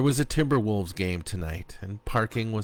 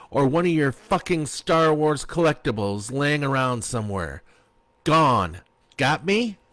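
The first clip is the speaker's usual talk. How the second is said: louder and higher, spitting each demand; furious order; 6 semitones higher, 5 dB louder than usual. A narrator's reading. Wife ordering her cheating husband out, furious and loud. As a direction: throw him out of the house.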